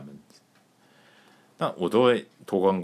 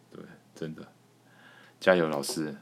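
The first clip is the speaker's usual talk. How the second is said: usual speaking voice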